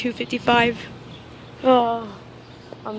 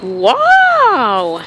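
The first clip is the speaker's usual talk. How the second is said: surprised; 8 semitones higher, 6 dB louder than usual. Real, not acted. Surprised by a train stopping.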